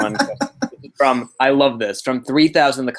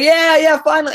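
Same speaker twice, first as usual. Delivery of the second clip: mock elated yell, pitched way up; excited cheer; 7 dB louder than usual. Retelling the others cheering Textbook on at last, excited cheer. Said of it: "it would be like".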